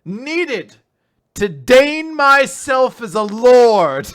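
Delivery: building to a slow, high, roaring shout; megalomaniac rant. Pretend. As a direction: rant like a mad self-crowned king. Escalating lordly rant, shouting.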